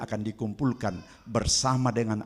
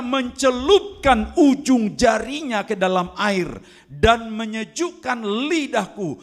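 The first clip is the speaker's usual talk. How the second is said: crying out; 8 semitones higher, 6 dB louder than usual. Acted. Rich man begging Abraham from the flames, crying out. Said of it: "Orang kaya itu berseru".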